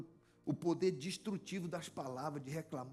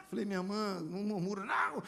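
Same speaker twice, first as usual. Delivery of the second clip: ordinary delivery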